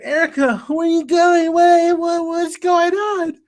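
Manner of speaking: high, drawn-out imitation of a helpless plea; plaintive mock wail. Michael left in the gutter calling after Erica, high mock wail. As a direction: voice him pleading in a put-on high voice.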